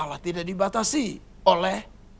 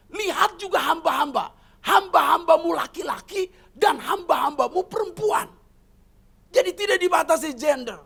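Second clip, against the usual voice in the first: forceful preaching; 7 semitones higher than usual. Acted. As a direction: press the point to the church.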